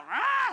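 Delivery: a sudden high roar, loud; animal growl. Retold or imitated. Pastor imitating a dog guarding its prize, loud growl. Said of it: "they growl".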